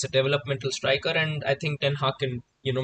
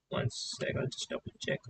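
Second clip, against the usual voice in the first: whispered aside; 11 dB quieter than usual. Real, not acted. Aside while host checks connection.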